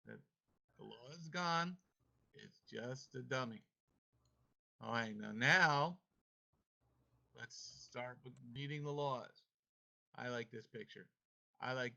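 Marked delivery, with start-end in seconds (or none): 5.3-6.0 sudden loud now; loud call. Real, not acted. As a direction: call the class to attention. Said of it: "calling class to order".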